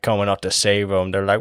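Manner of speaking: usual speaking voice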